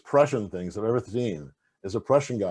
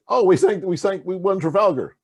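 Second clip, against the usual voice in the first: boastful English mock; 6 semitones higher, 5 dB louder than usual.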